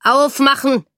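a loud, commanding shout at the door; pounding demand. A narrator's reading. Soldier at the door demanding entry, loud and commanding. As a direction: bang out the order. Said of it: "polterte Esther schon an der Tür".

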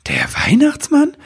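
can't believe it; astonished question. Acted. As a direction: elf woman amazed at the news. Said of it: "fragte Nibelwurz verwundert".

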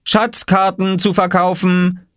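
high, drawn-out vendor's call; slow sing-out, pitched high. A narrator's reading. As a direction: cry your wares like a boy. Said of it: "rief Oskar".

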